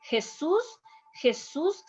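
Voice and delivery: "Jesus" said heh-SOOS, J as H; Spanish pronunciation. Shown as a demonstration. Teacher gives a patient's name as example, Spanish pronunciation. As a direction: give "Jesus" a Spanish H and end stress. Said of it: "keep the pronunciation like in your language".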